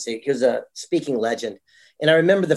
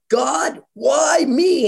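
mock anguished cry; 12 semitones higher, 4 dB louder, much slower than usual. Mentor crying out to God.

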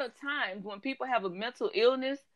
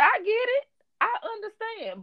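high-pitched imitated sympathy; acted, high-voiced reassurance; understanding reply; 12 semitones higher than usual. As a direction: go way up high to placate. Acted reply soothing the client.

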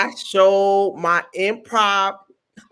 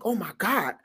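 soft, dropped-volume dread; acted-out hushed alarm; scared; 9 dB quieter than usual. Nervous auditioner realising she is next, scared. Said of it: "I was so scared".

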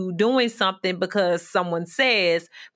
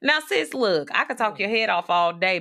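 ordinary delivery

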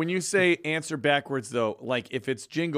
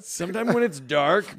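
laughing; 7 semitones higher, 5 dB louder than usual. Real, not acted. Amused echo of partner.

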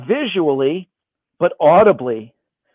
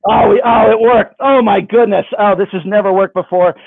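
elated; 3 semitones higher, 5 dB louder than usual. Real, not acted. Magician finding the trick worked, elated. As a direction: exclaim in delighted disbelief, voice jumping up.

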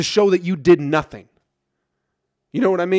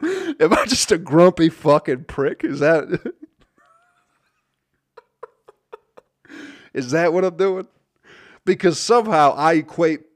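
laughing through the question at his own grumpiness; chuckling, half-slowed, amused at himself; 3 semitones higher, 5 dB louder than usual. Real, not acted. Host asks listeners if he's a grumpy prick, laughing.

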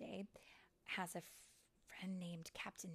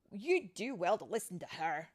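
louder, pointed warning; firm admonition; 12 dB louder than usual. Acted. Bobble advising Tink to listen, firm. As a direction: warn your fellow tinker.

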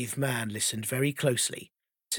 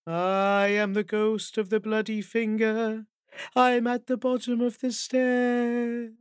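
slow eerie ghost moan, higher pitch; 7 semitones higher, slower than usual. Acted. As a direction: moan it like a ghost drawing near. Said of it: "it was louder".

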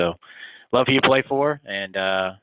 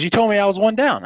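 high-pitched mock disbelief; imitated incredulous protest; 12 semitones higher, 4 dB louder than usual. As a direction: play the player's baffled protest, pitched up. Player protesting in retold story.

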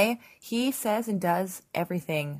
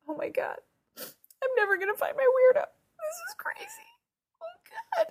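high squeaky weepy wail, hammed up; mock sobbing. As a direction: squeal it out as if overcome with tears. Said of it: "sobbing, clutching each other".

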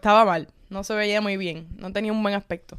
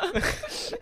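a burst of high laughter; 12 semitones higher, 5 dB quieter than usual. Real, not acted. Laughing while retelling the bar fight. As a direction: crack up mid-sentence.

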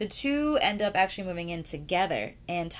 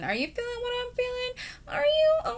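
much higher, hammed-up flirt; mock flirty lover. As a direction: squeak it out like a giddy new lover. Mock flirty line between the two women.

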